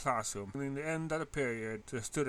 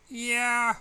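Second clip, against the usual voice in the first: wary; 9 semitones higher, 9 dB louder than usual. A narrator's reading. Wary reply.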